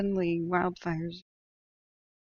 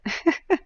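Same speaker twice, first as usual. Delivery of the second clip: real laugh; 10 semitones higher, 7 dB louder than usual. Real, not acted. Laughs at own outburst.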